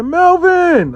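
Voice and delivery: high, loud cry of his name; drawn-out exclamation. Retold or imitated. Client greeting Melvin on the phone, high excited call.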